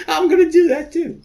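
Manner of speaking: very high, loud squeaky mimicry; squeaky mock voice. Reader mocking Rinda in a squeaky girly voice. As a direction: squeak it like a silly girl.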